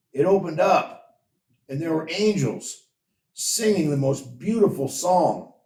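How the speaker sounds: eager big brother bursting with news; excited. A narrator's reading. David tells Benjamin about the angels, excited. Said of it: "never seen David so excited".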